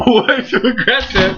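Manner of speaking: high, drawn-out disbelief; exaggerated shock. Fan retelling a wild Divas segment, exaggerated shock. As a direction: stretch it out high, playfully appalled.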